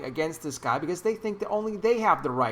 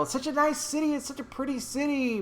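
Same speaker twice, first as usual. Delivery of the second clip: wistful praise; 9 semitones higher, 4 dB quieter than usual. Real, not acted. Fond praise of Seattle.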